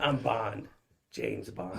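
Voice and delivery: low, soft mock-suave introduction; retold joke, quietly deadpan and low; James Bond. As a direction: drop the voice for a joking spy introduction. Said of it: "I was fucking around".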